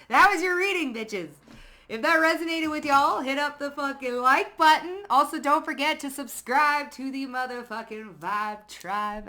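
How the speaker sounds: loud, high, shouted sign-off; hyped outro. Real, not acted. Reader signing off to her viewers, loud and hyped. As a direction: blast the goodbye like a hype host.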